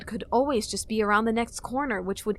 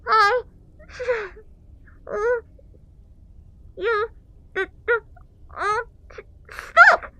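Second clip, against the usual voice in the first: high strained squeak; squeaky Deku voice; 14 semitones higher, 8 dB louder, much slower than usual. A narrator's reading. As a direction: struggle to squeak out words. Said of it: "irritated squeak".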